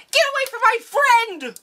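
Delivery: very high-pitched battle cry; hammed-up heroine's yell; mock fierce defender. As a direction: cry it out in a squeaky put-on voice. Acts out Speedy defending her friend.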